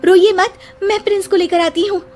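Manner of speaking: high, hurried reassurance; urgent young heroine. Acted. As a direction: rush off to rescue the child. Heroine rushes to rescue child.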